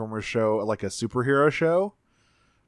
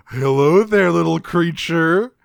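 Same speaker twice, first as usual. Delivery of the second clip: loud, higher cartoonish character voice; mock hearty greeting; 5 semitones higher, 6 dB louder, slower than usual. Bulkhead rolls up to greet the little creature, mock hearty. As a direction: hail a small creature in a put-on, drawn-out voice.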